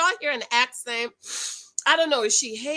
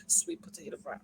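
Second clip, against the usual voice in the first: silly aside; 12 semitones lower, 12 dB quieter than usual. Pretend.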